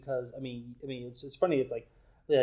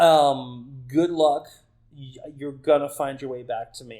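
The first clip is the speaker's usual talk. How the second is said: lightly voiced, loud confident taunt; a touch of amused certainty, pushed louder; mildly teasing, emphatic comeback; God; 12 dB louder than usual. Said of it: "And God's just like".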